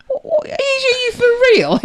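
high-pitched incredulous retelling, breaking into laughter; laughing disbelief. Retold or imitated. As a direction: squeal it in disbelief through laughter. Retold disbelief to Mike, laughing.

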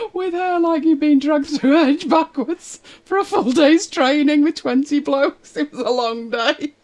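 high, giggly laughing retelling; laughing while telling. Real, not acted. Telling Sarah's wet mishap, laughing. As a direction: laughs through the tale of the drowned rat.

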